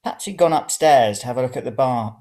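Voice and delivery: ordinary delivery, nothing marked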